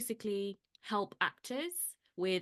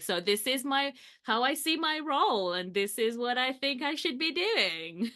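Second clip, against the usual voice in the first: lightly raised, upbeat pitching voice; confident pitch; 6 semitones higher, 6 dB louder than usual. Retold or imitated. To the panel, a light pitch-meeting voice. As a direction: sell yourself with a bright lift. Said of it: "I was like".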